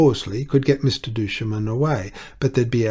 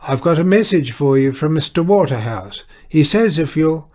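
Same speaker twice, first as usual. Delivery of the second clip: raised voice over the noise; loud; 6 semitones higher, 8 dB louder than usual. A narrator's reading. Tietjens speaks loudly across the table to Miss Wallop. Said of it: "as loudly as he could".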